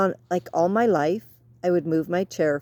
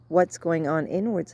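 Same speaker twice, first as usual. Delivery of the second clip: normal talking voice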